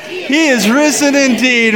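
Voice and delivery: high, slow, ringing reply; joyful shout. Acted. Townspeople answer the priest, shouting joyfully. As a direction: shout it back to the whole hall. Crowd answers the raised call.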